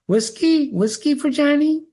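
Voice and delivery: high, strange begging voice of a simple man; the bar's simpleton pleading high for a drink; idiot Johnny Bear. Acted. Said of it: "he's got the idiot smile".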